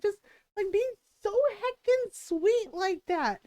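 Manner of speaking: high, slow teasing complaint; playful whine. Retold or imitated. Scolding her partner for being sweet, slow mock whine. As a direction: drag it out like a fond gripe. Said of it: "It's like".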